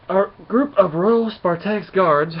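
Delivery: big drawn-out narrator's reveal; dramatic announcement. A narrator's reading. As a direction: announce the guards' entrance grandly. Dramatic reveal of guards' entrance.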